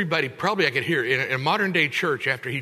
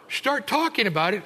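ordinary delivery

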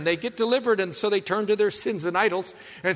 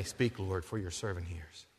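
humble hushed reply; 12 semitones lower, 13 dB quieter than usual. Retold or imitated.

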